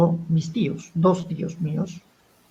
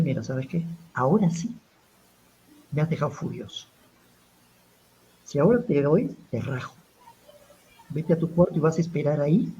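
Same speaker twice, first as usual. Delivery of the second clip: softer, held-in fury; quiet anger; 2 semitones lower, 6 dB quieter than usual. Retold or imitated. Uncle warning the nephew who ruined his drawing, quietly furious. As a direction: keep the voice down, anger boiling underneath. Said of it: "bajo la voz y hablo mucho más lento".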